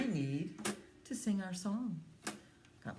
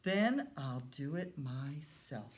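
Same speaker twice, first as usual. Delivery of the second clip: slow resolve; 4 semitones lower, slower than usual. Acted. Hen to her lazy friends, slow and firm. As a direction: say it slowly and firmly. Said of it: "she said".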